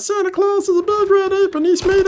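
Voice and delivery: very high cartoon child-like voice; squeaky, dopey cartoon kid; Meatwad. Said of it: "I can do a decent meat wad".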